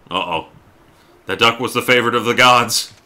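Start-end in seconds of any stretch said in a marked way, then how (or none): 1.3-2.9 loud, higher-pitched pronouncement; mock-epic proclamation. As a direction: proclaim it like an outraged herald of the gods. Mock divine verdict on killed duck.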